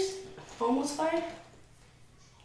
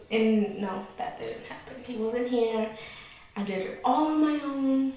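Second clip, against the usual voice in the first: usual speaking voice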